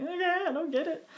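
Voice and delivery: put-on high-pitched, uncomprehending child's reply; puzzled acceptance. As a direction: go along with it, clearly lost. Child accepts explanation without understanding.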